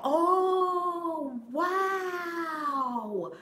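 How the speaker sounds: high, long drawn-out wonder; delighted, high-pitched amazement; amazed wow. Real, not acted. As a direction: stretch out an awestruck wow, high. Amazed by viewer's news.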